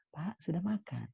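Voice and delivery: soft, slightly high, tender voice; gentle, respectful asking. Shown as a demonstration. Child gently asking father whether he has eaten, softly. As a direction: ask it softly and politely. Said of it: "Jangan gitu".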